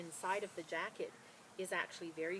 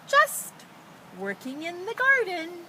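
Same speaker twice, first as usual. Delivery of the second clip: much higher, louder, sing-song little girl playing innocent; high innocent child's voice; 11 semitones higher, 14 dB louder than usual. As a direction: squeak it out like a girl hiding something. Girl voiced in read story.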